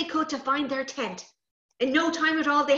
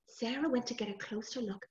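usual speaking voice

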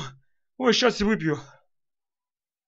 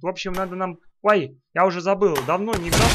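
ordinary talk

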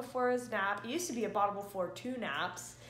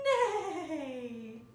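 horse neigh; 6 semitones higher, 3 dB louder than usual. Pretend. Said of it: "What does the horse say?".

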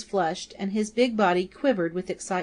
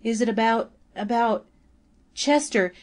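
fearful cry; 4 semitones higher than usual. A narrator's reading. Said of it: "she cried".

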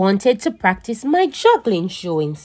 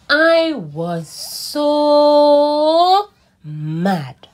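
high, loud, very slow drawn-out complaint; mock angry wail; 7 semitones higher, 5 dB louder, much slower than usual. Child narrator fed up with grown-ups, a slow high wail. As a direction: stretch it out like a stomping kid.

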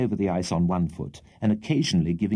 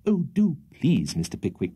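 eager plea; 5 semitones higher than usual. A narrator's reading. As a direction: beg him to try. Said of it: "cried all the ladies".

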